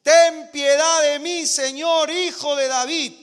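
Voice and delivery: loud, high, slow cry for mercy; desperate mother shouting after Jesus; shouting plea. Acted. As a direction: shout it out, begging. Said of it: "viene gritando".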